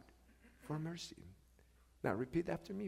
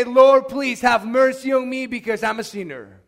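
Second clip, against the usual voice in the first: raised, pleading voice; crying out for mercy; 2 semitones higher, 13 dB louder than usual. Acted. Sinner praying to the Lord, crying out for mercy. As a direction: plead aloud like a churchgoer in need. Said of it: "you're crying out for mercy".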